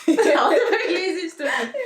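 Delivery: laughing through the confession; giggling it out, high and amused. Real, not acted. Admitting to the host she was a lazy student, laughing. Laughing repeat of own line.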